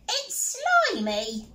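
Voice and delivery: high, slow squeal of disgust; disgusted pirate girl. Acted. Peg complains to the crew about the fish pie, high and slow.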